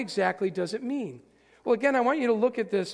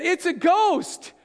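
mock fright; 9 semitones higher, 5 dB louder, slower than usual. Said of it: "they said".

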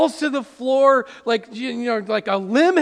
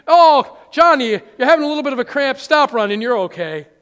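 loud, raised, over-concerned coach call; mock coach alarm; 4 semitones higher, 9 dB louder than usual. Coach to an athlete with a cramp, mock alarm. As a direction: fuss loudly over the player, easing off at the end.

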